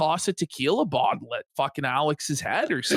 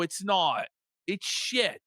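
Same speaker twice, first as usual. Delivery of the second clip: ordinary talk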